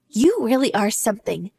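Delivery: ordinary talk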